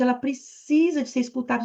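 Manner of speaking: ordinary talk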